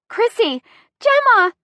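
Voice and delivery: high, frantic calling out; screamed names. A narrator's reading. Friends calling out during a kidnapping.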